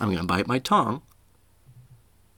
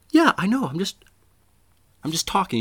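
irritated defensiveness; 9 semitones higher than usual. Acted. Irritated reply to being corrected.

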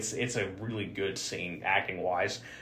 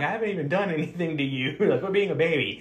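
laughing mock teacher; 6 semitones higher than usual. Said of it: "Master Tien's like".

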